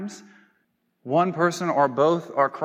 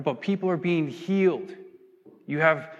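ordinary talk, nothing marked

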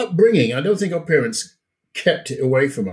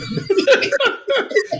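high laugh. Real, not acted. Laughs at customs story.

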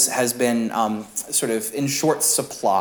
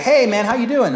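higher, casual friend's hello; friendly greeting voice; 10 semitones higher, 4 dB louder than usual. Retold or imitated. A friend greeting the new father, higher and casual. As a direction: play a buddy asking. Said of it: "someone say".

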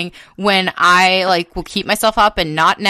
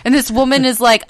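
ordinary talk

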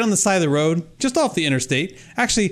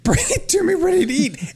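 mock demand; 8 semitones higher than usual.

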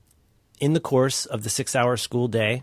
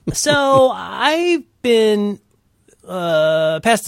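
laughing drawl; 8 semitones higher, 5 dB louder, much slower than usual. Real, not acted. Laughing drawn-out start.

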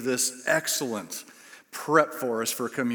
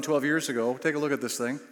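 ordinary talk, nothing marked

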